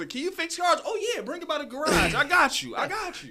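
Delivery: high, loud overconfident promise; mock bragging liar. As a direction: oversell it like a fake mechanic. Mocking people who lie about skills.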